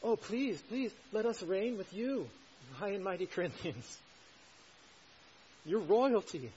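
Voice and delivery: high-pitched mock begging; sarcastic. Preacher voicing Paul begging the high and mighty Corinthians, sarcastic. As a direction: fawn on them with exaggerated fake reverence. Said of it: "Paul says sarcastically".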